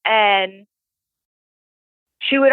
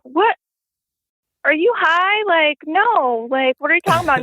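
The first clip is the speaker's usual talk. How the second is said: incredulous; 7 semitones higher than usual. Retold or imitated. Retold denial of groping.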